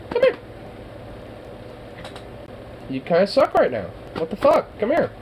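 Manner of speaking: high, bright sing-song call to a dog. Real, not acted. Owner calling the dog to come, high coaxing call.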